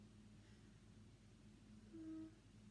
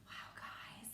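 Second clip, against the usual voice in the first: hushed wow; breathy awed whisper; 13 dB quieter than usual. Real, not acted. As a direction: whisper in awe. Whisper to class.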